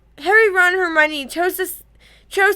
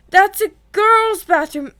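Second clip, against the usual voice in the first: higher, louder, scandalised exclamation; shocked gasp; 5 semitones higher, 5 dB louder than usual. A narrator's reading. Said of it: "he gasped".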